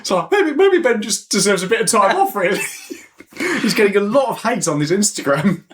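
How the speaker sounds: laughing. Real, not acted. Laughing teasing.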